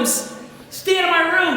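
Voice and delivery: high mock-exasperated order; put-on sibling irritation; annoyed sibling. Acts out annoyed older sibling.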